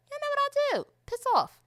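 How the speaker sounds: high-pitched sassy protest; mock indignant retort. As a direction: tell off the critics in a high, exaggerated voice. Mock retort to those judging her.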